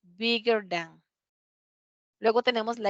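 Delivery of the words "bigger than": drawn-out, careful English; slow model phrase. Shown as a demonstration. Teacher showing the comparative form to class, slowly. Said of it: "duplicamos la última consonante".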